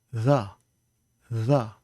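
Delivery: "the" com th soprado; língua quase no palato em "the"; fricative th. Shown as a demonstration. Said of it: "if we say the fricative sound".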